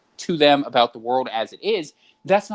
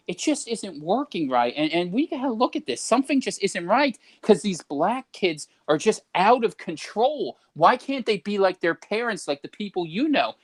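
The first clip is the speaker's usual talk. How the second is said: higher, coaxing concerned tone; persuader voice; 6 semitones higher than usual. Retold or imitated. Steering a boomer toward blame, higher coaxing voice. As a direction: lead them along, pitched up and earnest. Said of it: "I'm just like".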